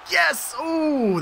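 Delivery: high, thrilled exclamation; excited cheer. Real, not acted. Player cheering a hit in the match, excited. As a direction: let the pitch leap in triumph.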